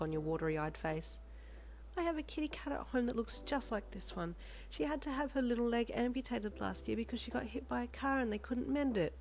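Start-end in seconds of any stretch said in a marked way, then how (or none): 2.0-9.2 sad smile. Acted. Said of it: "she said with a sad smile".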